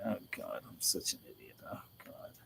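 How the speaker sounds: very soft, sinking to a whisper; self-reproach under breath. Real, not acted. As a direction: mumble it to yourself, voice dropping away. Berating self over the mistake.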